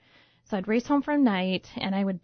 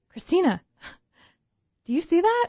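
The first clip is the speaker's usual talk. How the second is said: excited call; 9 semitones higher, 5 dB louder than usual. Retold or imitated. Friend points out the sign.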